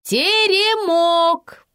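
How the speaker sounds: high, loud, long-drawn shout; eager high-pitched yell; calling out answer. A narrator's reading. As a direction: holler the answer, stretching it right out. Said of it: "снова отозвалась я".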